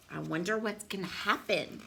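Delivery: usual speaking voice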